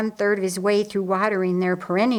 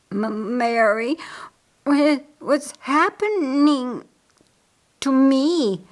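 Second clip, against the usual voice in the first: slow, slurred, higher plea; stricken stammer; 5 semitones higher, 3 dB louder, slower than usual. A narrator's reading. Laz asks Mary what is happening, slurred stammer. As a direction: struggle haltingly to get words out. Stricken man struggles to speak.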